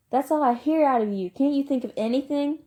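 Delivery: loud, high, furious scolding; angry older brother at full volume; shouting. Acted. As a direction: yell at him in exasperation. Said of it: "Derry shouted".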